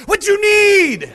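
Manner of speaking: put-on high, loud, slow Indian accent, teasing; mock rude attendant. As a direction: answer the kids in the attendant's gruff voice. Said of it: "you're gonna go".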